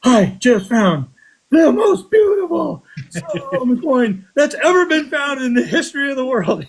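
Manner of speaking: high, thrilled, laughing through it; excited announcement. Retold or imitated. Finder announcing on walkie-talkie to the club, high and excited. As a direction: gush like you found treasure. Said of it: "and I'm like".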